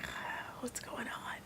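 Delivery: slow, whispered what's going on; whispered confused aside; nervous whisper. Retold or imitated. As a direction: breathe it out unsure, under her breath. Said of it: "and I was like".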